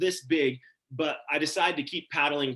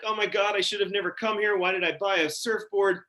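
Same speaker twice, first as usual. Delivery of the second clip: higher-pitched inner panic, mildly acted; mock freaking out; 6 semitones higher than usual. Surfer on the board regretting coming, mild mock panic. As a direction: retell a panicked thought in a raised pitch. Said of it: "I'm freaking out".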